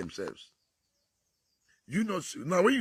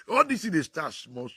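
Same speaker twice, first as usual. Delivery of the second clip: normal talking voice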